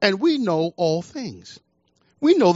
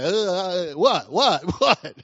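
lower, halting confused stammer, lost for words; dumbfounded stammer; 3 semitones lower, much slower than usual. Retold or imitated. Congregation baffled when Jesus speaks, stammering confused. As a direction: play the baffled churchgoer fumbling for an answer. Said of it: "we sit there like".

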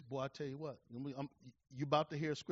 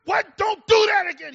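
furious shout, much higher and louder; angry yell; 15 dB louder than usual. Retold or imitated. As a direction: bellow at the kids to get away. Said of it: "He yelled".

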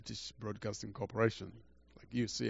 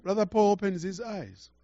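higher and louder, proclaiming it; preaching emphasis; 9 semitones higher, 8 dB louder than usual. Acted. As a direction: lift it up and proclaim it to the congregation. Preacher repeats line for emphasis.

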